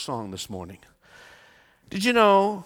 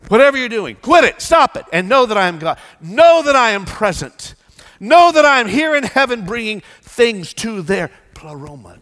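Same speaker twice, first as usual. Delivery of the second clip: higher, louder preaching; preaching at full force; 9 semitones higher, 12 dB louder than usual. Acted. Preacher urging the church to know God is present. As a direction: drive it home to the church.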